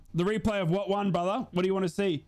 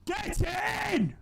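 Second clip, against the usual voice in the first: victory shout; 11 semitones higher than usual. Real, not acted. Celebrating the win.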